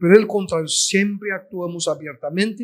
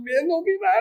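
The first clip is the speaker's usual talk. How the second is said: imitated crying; 12 semitones higher, faster than usual. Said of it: "Ella me dijo".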